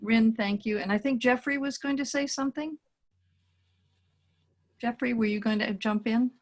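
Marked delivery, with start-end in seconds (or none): none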